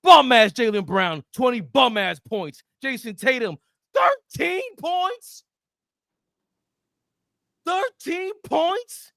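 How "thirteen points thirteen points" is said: high, slow disbelieving shout; incredulous. Real, not acted. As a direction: stretch it out in mock disbelief. Mocking Tatum's low scoring.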